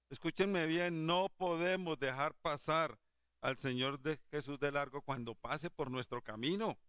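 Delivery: ordinary delivery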